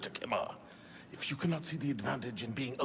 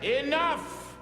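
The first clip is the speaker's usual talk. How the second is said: sharp, much higher shout to cut them off; commanding shout; 13 semitones higher, 3 dB louder than usual. Acted. Mirabeau silences Belek in the council, shouting. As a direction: slam the argument shut.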